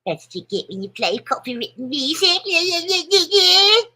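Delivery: high, loud taunting sing-song; mock the flaggers in a high squeal. Mocking the copyright flaggers.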